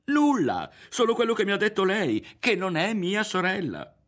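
very high, loud, frantic answer; worked-up, high-voiced protest; agitated retort. A narrator's reading. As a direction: fire back defensively at high pitch. Berto answers back in quarrel.

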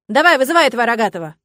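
loud, fast command; urgent, forceful demand. A narrator's reading. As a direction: order it, eager for a fight. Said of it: "блондинка и потребовала".

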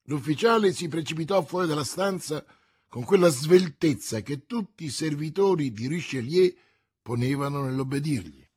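plain reading voice

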